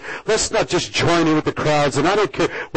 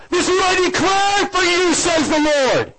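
rising proclamation; 12 semitones higher than usual. Acted. Pastor declaring God's word over the congregation, rising. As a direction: declare it boldly.

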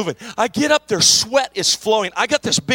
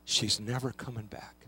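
grieving clerk; 9 semitones lower, 10 dB quieter than usual. Retold or imitated. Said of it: "And he said".

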